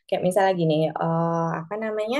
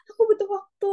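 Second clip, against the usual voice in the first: put-on much higher, small-kid request; child's voice; 13 semitones higher than usual. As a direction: ask for time like a little child. Parent voicing child.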